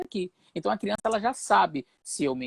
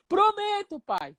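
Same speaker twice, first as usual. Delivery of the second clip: put-on child promising; slower than usual. Boy promising father after pleading.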